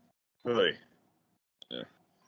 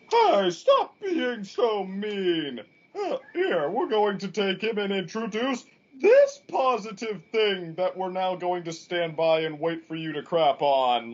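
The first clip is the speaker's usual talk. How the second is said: high, loud, drawn-out mock plea; put-on high-pitched, slow wail of protest; whiny mock cherubs; 11 semitones higher, 4 dB louder than usual. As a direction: plead in an exaggerated high cherub voice. Mimics the cherubs' whining plea.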